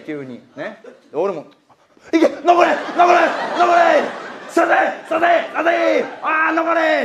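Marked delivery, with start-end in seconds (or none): 2.1-7.0 high-pitched retold yell; yelling the horse home. Speaker yelling against the old man in a dead heat, retold yell. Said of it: "って言って".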